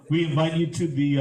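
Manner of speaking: ordinary delivery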